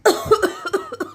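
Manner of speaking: loud hammed-up coughing; put-on cough. Mother-in-law coughs again, loud and hammed-up. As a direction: cough it out hard.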